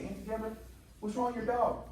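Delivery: put-on a small boy calling up to his auntie; little kid asking. Young [name] asks his auntie about her dog, in a high kid voice. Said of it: "and I say".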